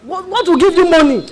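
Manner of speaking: incredulous angry big sister; scolding outburst. Acted. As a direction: tell her off loudly. Scolding sister over choice.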